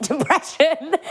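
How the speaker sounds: high, slow, laughing through it; real laughter slipping in. Real, not acted. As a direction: let a laugh break the word. Laughing mid word.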